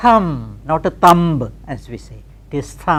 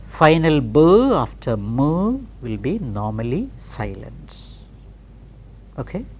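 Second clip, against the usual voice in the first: ordinary delivery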